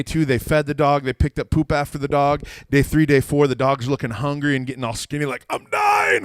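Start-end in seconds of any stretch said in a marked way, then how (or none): 5.5-6.2 very high, loud comic wail; dying dog. Retold or imitated. Neglected dog to the family, melodramatic wail. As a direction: wail it like a starving pet.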